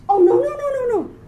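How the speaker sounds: high, rapid string of no's; loud, high-pitched alarm; dismayed realization. Real, not acted. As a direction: protest in dismay at your own slip. Realizes her mistake on the board.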